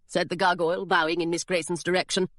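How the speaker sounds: ordinary delivery, nothing marked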